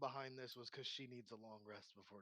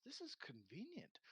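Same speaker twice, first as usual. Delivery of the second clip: wry innkeeper; 7 semitones higher, 8 dB quieter than usual. Acted. Innkeeper drags floating man upstairs.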